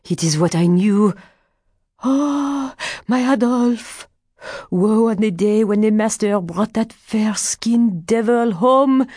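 high wail rising into a curse; anguished cry. A narrator's reading. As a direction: lament loudly, high and bitter. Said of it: "cried Adele".

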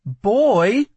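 high, loud warning word. A narrator's reading. Lila to guard who won't move, warning. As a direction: warn him sharply. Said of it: "she warned".

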